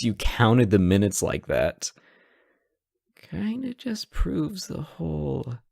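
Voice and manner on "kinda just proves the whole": whispered aside, obsessed thing; teasing whisper. Acted. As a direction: drop to a sly whisper on the last words.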